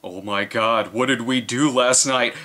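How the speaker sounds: ordinary talk, nothing marked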